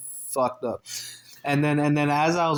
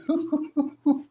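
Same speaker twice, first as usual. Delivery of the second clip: real high-pitched laugh; high giggling; 13 semitones higher than usual. Real, not acted. Storyteller laughing at the clerk's retold dare, high giggle.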